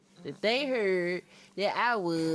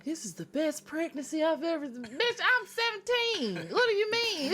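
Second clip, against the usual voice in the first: high, indignant disbelief; incredulous retort; 10 semitones higher than usual. Retold or imitated. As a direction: protest it can't be, she's only seventeen. Doctor quote then protest, high.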